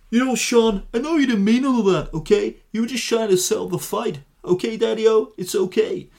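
hammed-up Liverpool Beatle reassuring a fighter; [name]. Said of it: "What would [name] say?".

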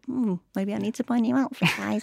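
light shopping-voice chirp; chirpy sing-song shopping voice; talking doll. Acted. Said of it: "That's my voice when I'm going shopping".

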